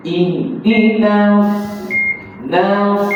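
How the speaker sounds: tongue up for the thick s of "Nas"; heavy sad. Shown as a demonstration. Quran teacher models tongue position, "Nas" with heavy sad. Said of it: "Lidah kita menyentuh".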